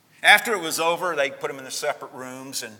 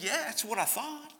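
put-on small boy's answer; 12 semitones higher, 3 dB quieter than usual. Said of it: "And he said".

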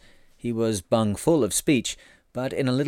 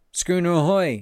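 higher, louder hail across the water; yelling; 7 semitones higher, 4 dB louder than usual. A narrator's reading. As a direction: call out to the nearest schooner. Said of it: "yelled George".

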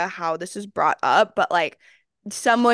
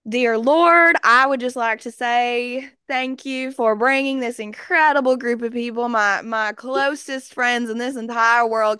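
high, earnest mock-Southern prayer; ridiculous Southern-accented thanksgiving, fully committed; Southern grace; 3 semitones higher than usual. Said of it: "like, Southern, like, giving thanks".

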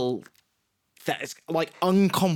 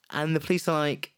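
usual speaking voice

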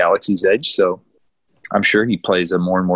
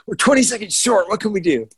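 higher, hammed-up worried producer; mock panicked creator; 9 semitones higher than usual. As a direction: play the flustered creator. Imitating creators short on time.

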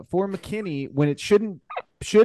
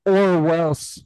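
mock threat; 3 semitones higher, 5 dB louder than usual. Reading comment's ominous ending.

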